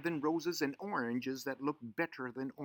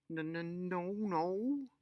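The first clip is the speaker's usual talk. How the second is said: nervous stammer; 7 semitones higher, much slower than usual. Acted. Bridget caught, stammering denial.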